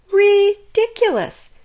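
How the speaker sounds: high, loud, drawn-out chirp; cheeping bird. A narrator's reading. Bird scoffing at the tortoise, high cheep. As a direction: chirp it with scorn. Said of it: "cheeped the bird".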